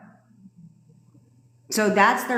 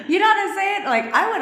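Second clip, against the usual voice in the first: high burst of laughter into the question; laughing; 13 semitones higher than usual. Real, not acted. Laughs at own joke.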